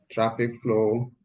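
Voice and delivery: ordinary delivery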